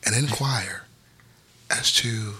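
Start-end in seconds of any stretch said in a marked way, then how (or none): 1.7-2.4 hushed whisper. Acted. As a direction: whisper the words. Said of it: "Why are you whispering?".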